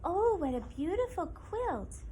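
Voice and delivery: Mother Deer admiring. Acted. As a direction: marvel at the quilt as Mother Deer. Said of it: "said Mother Deer".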